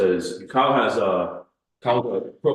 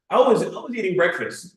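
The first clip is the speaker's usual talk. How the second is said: higher-pitched mock-angry retelling; retold anger; 7 semitones higher than usual. Recounting an angry video to the host, mock indignant. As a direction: imitate someone worked up and complaining. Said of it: "he's, like, really angry in the video".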